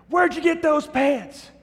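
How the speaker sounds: high, slow mocking heckle from the back; heckler yelling. Guy in the back heckling the MC, high mocking yell. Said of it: "He'd say".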